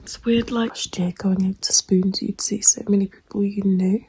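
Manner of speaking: hushed late night whisper. Real, not acted. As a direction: whisper so no one wakes.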